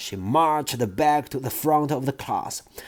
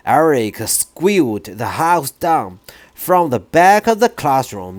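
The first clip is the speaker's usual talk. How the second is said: plain reading voice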